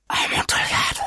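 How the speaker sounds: Amontillado whispered, breathless and dreamy; whispered craving. Acted. Fortunato murmurs the wine's name. (Amontillado geflüstert, fast tonlos)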